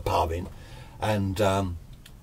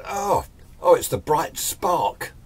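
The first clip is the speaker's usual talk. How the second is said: mocking taunt; 10 semitones higher, 3 dB louder, slower than usual. School bully taunting narrator.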